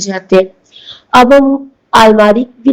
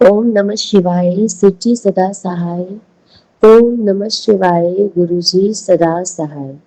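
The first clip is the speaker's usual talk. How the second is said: slow, softer mantra chant; devotional mantra recitation; 3 semitones lower, 5 dB quieter, slower than usual. Real, not acted. Devotee opening her satsang with the mantra, slow devotional recitation. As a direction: intone the invocation slowly and reverently.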